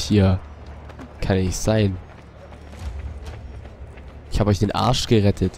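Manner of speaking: usual speaking voice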